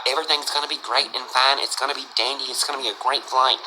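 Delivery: deep, soft intercom pilot voice; pilot reassurance. Retold or imitated. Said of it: "the guy's still on the intercom".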